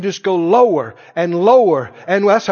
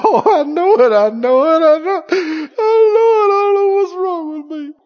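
higher, tearful, breaking wail of regret; mock sobbing confession; 7 semitones higher, 3 dB louder than usual. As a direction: whimper it like a guilty sinner in tears. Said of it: "You go".

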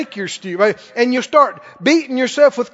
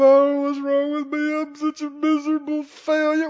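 mock crying; 2 semitones higher, 5 dB quieter than usual. Preacher mocking a believer sorry for himself, fake crying. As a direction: put on a sobbing, self-pitying moan. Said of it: "Crying, feeling sorry for yourself".

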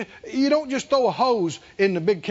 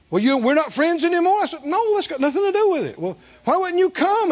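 offended colleague; 7 semitones higher than usual. Retold or imitated. Minister quarrels in retold dialogue.

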